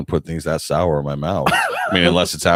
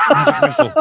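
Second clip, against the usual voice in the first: high squeaky spell cast; squeaky wizard voice; 5 dB louder than usual. Pretend. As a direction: shriek the spell like a silly wizard. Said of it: "I hate you already".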